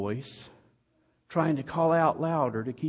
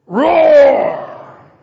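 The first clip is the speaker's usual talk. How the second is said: loud high roar; Satan's lion roar; 14 semitones higher, 16 dB louder than usual. Acted. Preacher voicing Satan, roaring loud like a lion. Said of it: "And Satan goes".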